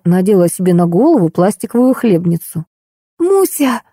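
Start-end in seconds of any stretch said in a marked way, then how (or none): 3.2-3.8 high-pitched squeezed plea; pinned-down Машка. A narrator's reading. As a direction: plead from under someone. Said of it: "попросила придавленная Машка". (высоким голоском придавленной девочки)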